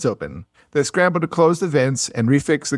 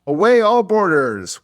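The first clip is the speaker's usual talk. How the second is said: higher, slower naval order; called-out order; 7 semitones higher, 4 dB louder, slower than usual. Acted. Narrator quoting a Navy captain's last boarding order, called out. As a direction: draw out the command to the crew. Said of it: "it's the last time the order".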